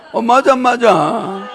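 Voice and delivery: slow, laughing yes; amused yes. Real, not acted. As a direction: chuckle out the agreement. Laughing agreement.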